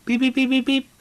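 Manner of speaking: rapid high, loud beeps; string of electronic-style beeps on one high pitch; imitated meter beeping. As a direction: answer as a beeping machine. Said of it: "she would beep yes".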